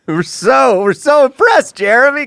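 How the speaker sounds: loud, high mock-impressed cheer; sarcastic. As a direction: fake applause sarcasm.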